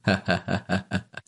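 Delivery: short breathy run of ha-ha; laughing. A narrator's reading. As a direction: chuckle in quick, even bursts. Villainous-hearted hero laughs at worry.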